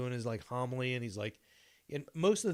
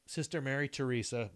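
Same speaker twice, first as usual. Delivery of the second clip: ordinary talk, nothing marked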